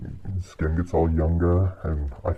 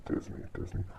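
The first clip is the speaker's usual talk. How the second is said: exasperated whisper; 13 dB quieter than usual. Real, not acted. Exasperated muttering at Disney.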